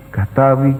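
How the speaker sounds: normal talking voice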